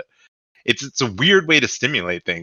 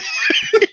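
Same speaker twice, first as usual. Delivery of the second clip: high breathless laugh; 3 dB louder than usual. Real, not acted. Laughs at own impression.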